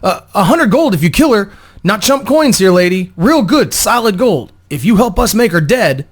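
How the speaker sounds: high, loud stammering plea; a scared thug bargaining for his life; afraid, desperate stutter. Acted. Thug begging the woman to kill her, stammering. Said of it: "The other stuttered as he spoke up, clearly afraid but desperate".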